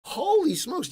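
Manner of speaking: very loud, high-pitched disbelief; playfully aghast, voice shooting way up; mock outrage. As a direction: yelp it in hammed-up shock. Mock shock at being berated.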